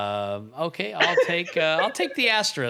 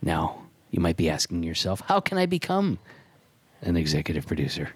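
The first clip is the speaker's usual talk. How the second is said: announcer voice; 8 semitones lower than usual. Acted. Sponsor read announcer voice.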